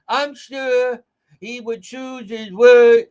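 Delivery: slow, loud, high mocking accent; mock Charlene Lima accent. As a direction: drag out her strong accent in mockery. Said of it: "in that strong Eileen Violent accent".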